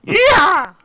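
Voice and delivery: high, loud whoop; excited shout. Real, not acted. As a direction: yell it out in triumph. Loud cheer after the station ID.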